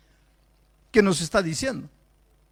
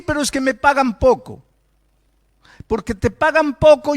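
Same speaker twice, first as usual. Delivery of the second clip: loud, driving preacher's rebuke; preaching at full force; 4 semitones higher, 7 dB louder than usual. Acted. Preacher rebuking the congregation for their bad character, loud and driving. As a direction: hammer each question home from the pulpit.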